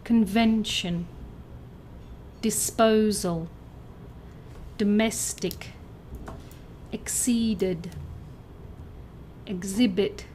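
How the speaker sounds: "Convention. Disposal. Domestic. Exceeded. Exhibit.", each stressed on its second syllable. Shown as a demonstration. Teacher reads out answers, second-syllable stress from "Convention" to "Exhibit". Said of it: "the second syllable".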